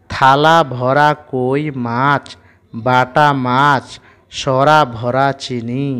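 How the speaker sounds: very slow, word by word reading; slow rhythmic recitation of a children's rhyme; slow reading aloud. Shown as a demonstration. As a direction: read each word slowly so children can follow. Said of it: "reading rhyme without spelling".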